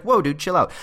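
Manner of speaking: high, easygoing calm-down voice; laid-back friend. Retold or imitated. Friend telling an angry commuter to relax, mock laid-back. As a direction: soothe your mate in a mock surfer tone. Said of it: "your friend's like".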